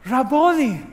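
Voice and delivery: a high, loud cry of recognition. A narrator's reading. Mary crying out to Jesus at the tomb, loud and high. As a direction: call out his name in sudden joy. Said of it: "she cried out in Aramaic".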